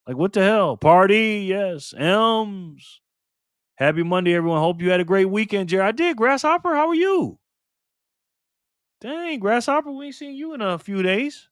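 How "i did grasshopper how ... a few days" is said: squeaky greeting. Acted. Greeting viewer nicknamed Grasshopper.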